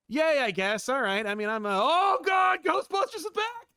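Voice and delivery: put-on a kid talking himself into joy; forced excitement. Kid watching Casper forcing excitement, then a high squeal. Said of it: "just being like".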